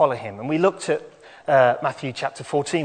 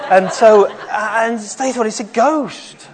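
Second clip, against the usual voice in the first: dramatic spooky exclaim; 9 semitones higher, 9 dB louder, slower than usual. Acted. Dramatic ghost moment for children.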